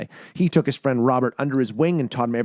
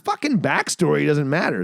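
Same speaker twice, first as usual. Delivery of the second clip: ordinary talk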